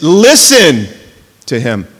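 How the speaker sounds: "listen" drawn out slow and strong, "to him" dropped low; stress on LISTEN. Shown as a demonstration. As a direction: lean hard on "listen", let "to him" fall away. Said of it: "let's put the emphasis there".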